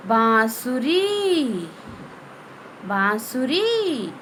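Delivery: बांसुरी read slowly, the nasal vowel held; slow nasal demo. Shown as a demonstration. Teacher models बांसुरी for the class, slowly. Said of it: "इसी प्रकार बच्चों".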